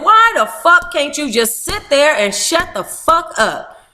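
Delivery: mock outburst; retold exasperated yell. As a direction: snap at him like a fed-up sister. Retold yelling at brother.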